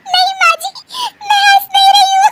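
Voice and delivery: very high, squealing through laughter; giggle-choked protest; laughing denial. Acted. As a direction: deny it while helplessly cracking up. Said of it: "अरे फिर से हंस रही हो".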